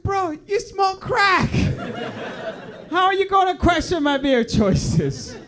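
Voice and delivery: high, loud, slow outraged retort; mock outrage at a crackhead friend; indignant. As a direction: laugh through a disbelieving yell.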